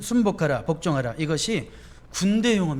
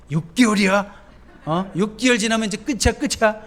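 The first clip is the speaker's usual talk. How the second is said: louder, higher taunting devil; devil's voice; 6 semitones higher, 6 dB louder than usual. Retold or imitated. Preacher voicing the devil pushing a newlywed, taunting and loud. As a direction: egg them on, sneering. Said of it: "마귀는 뭐라 그래요".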